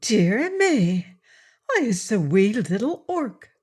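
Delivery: put-on delighted little girl's surprise; mild gasp of wonder; surprised. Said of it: "said in surprise".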